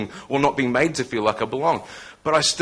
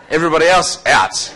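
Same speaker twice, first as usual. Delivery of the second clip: mock dismissal; 5 semitones higher, 7 dB louder than usual. Real, not acted. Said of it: "joking with audience".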